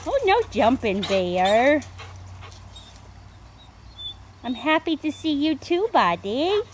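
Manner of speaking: loud, high, happy scolding; excited telling a jumpy dog off. Real, not acted. Dog jumping up on her.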